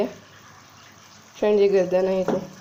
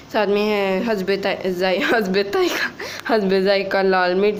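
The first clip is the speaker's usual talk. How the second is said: normal talking voice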